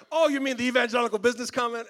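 high, loud, fast mock realization; feigned realization. Pastor pretending to just recall officer's comment, feigned realization. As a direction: blurt it as if it only now dawned on you. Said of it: "act like I didn't know".

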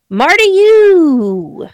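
high, drawn-out name reveal; showy self-introduction. Pretend. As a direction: stretch the name out high and loud. Drawn-out mock host introduction.

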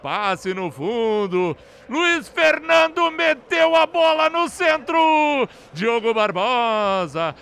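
high, loud celebratory recap; excited goal recap. Real, not acted. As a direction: relive the goal, shouting. Goal replay narration. (narração empolgada do gol)

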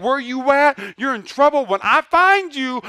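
hammed-up scolding, ready to whoop; angry parent threat. Preacher mocking a God out to whoop Adam, loud and hammed-up. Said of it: "Like he's like".

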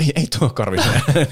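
ordinary delivery

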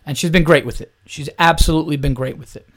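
normal talking voice

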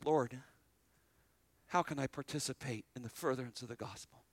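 quiet prayer. Retold or imitated. A believer quietly asking the Lord how to serve. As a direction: pray it softly and humbly.

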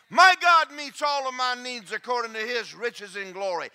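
loud, high preaching cadence; preaching at full force. Acted. Preacher rallying the congregation, loud and high. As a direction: shout the promise to the congregation.

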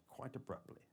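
usual speaking voice

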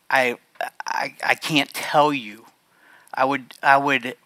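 emotion stealing the voice mid-sentence; choked whisper. Real, not acted. Thanking the audience after speaking of his dad, a choked whisper.

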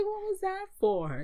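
very high-pitched imitated yelp of protest; mock indignant complaint. Simba after Rafiki bops him, mock indignant. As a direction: complain in an exaggerated high squeal.

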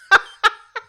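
high-pitched giggling fit; loud squealing laugh; high helpless giggle. Acted. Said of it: "couldn't stop laughing".

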